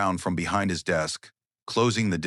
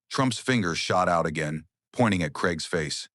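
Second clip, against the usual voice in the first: ordinary delivery, nothing marked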